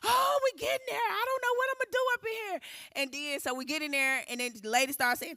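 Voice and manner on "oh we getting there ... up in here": high, tearful and fast; crying, frightened. Retold or imitated. On the phone to her mother-in-law, tearful and panicked. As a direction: babble through tears, lost and scared. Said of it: "crying in the car to her".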